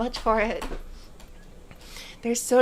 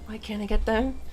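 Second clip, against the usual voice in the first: usual speaking voice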